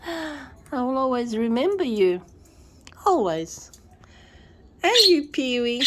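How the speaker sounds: usual speaking voice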